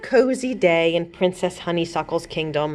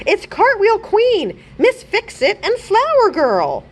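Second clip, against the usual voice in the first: excited announcing; 13 semitones higher, 3 dB louder than usual. A narrator's reading. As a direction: call out each hero with delight. Goat Avenger announces new heroes.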